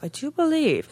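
high, prim put-on voice. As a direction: say it like a prissy lady. Repeats phrase in new voice.

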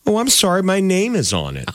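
high-pitched taunting neighbor-kid imitation; mock apology. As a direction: mimic a kid's fake sorry, rubbing it in. Neighbor kid refusing to return ball.

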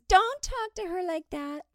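high, scolding mock protest; prissy girlfriend voice. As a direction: defend her in a prim voice. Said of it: "their girlfriends".

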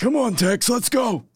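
raspy coach impression; Phil Jackson. Coach urging his old assistant along, raspy imitation. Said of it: "he's got that raspy voice".

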